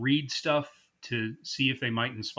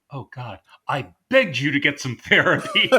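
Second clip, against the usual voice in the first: exasperated outburst; 3 semitones higher, 9 dB louder than usual. Retold or imitated. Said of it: "Sidney Pollack just going".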